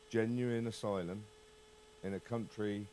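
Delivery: ordinary talk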